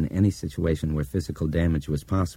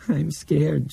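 put-on higher, frightened child voice; scared child; 10 semitones higher than usual. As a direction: say it again, more frightened. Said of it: "she repeated".

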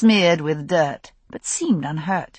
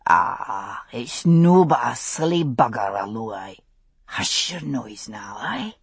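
gruff Scots hush; 7 semitones lower than usual. Acted. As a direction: settle the horse down in Scots. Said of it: "said a gruff voice".

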